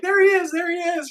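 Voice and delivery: high excited cheering; family cheering. Retold or imitated. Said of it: "They're like".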